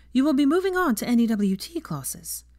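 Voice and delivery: a professor ending her lesson, lightly voiced teacher. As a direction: speak up to the class like a teacher. Teacher addresses her class.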